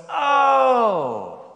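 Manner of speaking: long, loud, high falling "oh"; hammed-up cry of recognition; mock realization. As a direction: draw it out high and loud, sliding down. Manager's exclamation before the punchline.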